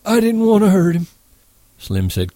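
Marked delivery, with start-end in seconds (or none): 0.0-1.1 high, tearful plea; Lenny crying to the men, high. Acted. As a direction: plead through tears.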